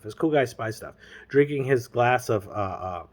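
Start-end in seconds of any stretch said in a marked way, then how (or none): none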